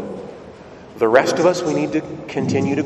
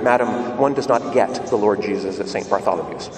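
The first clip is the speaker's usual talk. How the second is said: low, formal usher's reproof; disapproving usher; 7 semitones lower than usual. Retold or imitated. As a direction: play a stuffy church usher putting her in her place. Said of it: "the usher said to her afterwards".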